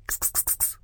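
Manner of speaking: unvoiced cat call; whispered kitty call. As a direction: hiss a soft kitty call. Подзывает кошку. (шёпотом подзывая кошку)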